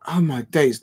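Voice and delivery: usual speaking voice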